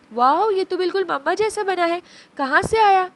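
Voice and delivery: put-on high, loud childish wow; delighted child. Abi tastes the pasta and exclaims with delight. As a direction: gush like a thrilled little girl.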